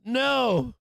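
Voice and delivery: high, loud emphatic yell; shouted no. Acted. Streamer answering his question to critics, shouted no. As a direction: holler the answer to your own question.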